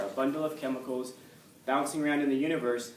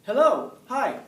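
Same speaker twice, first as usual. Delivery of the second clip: much higher, louder, sing-song hello; cheery greeting; 10 semitones higher, 10 dB louder, slower than usual. Shown as a demonstration. Teacher demos a greeting for the class, bright and eager. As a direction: greet them like you're thrilled to see them. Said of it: "Now, how do I say it?".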